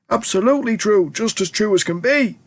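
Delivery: put-on an old grandparent insisting with glee; eager old grandparent. A narrator's reading. Grandparents correcting Charlie, high and eager.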